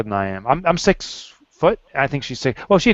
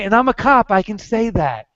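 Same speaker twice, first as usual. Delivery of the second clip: mock cop; 7 semitones higher than usual. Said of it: "pull out your badge and say".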